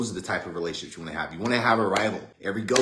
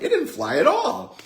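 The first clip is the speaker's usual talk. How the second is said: very high, loud mock kid protest; little sister voice; 6 dB louder than usual. Little sister argues back.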